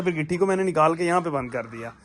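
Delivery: ordinary delivery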